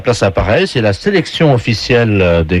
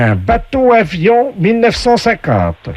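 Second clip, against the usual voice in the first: slow, higher game voice; game show announcer; 9 semitones higher than usual. Acted. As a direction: announce each prize with drawn-out flourish. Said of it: "la voix du jeu".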